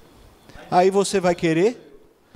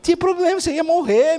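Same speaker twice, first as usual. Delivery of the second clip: high, loud exasperated retort; mock scolding; 13 semitones higher, 5 dB louder than usual. Preacher rebuking the socket-poking baby, loud mock outrage.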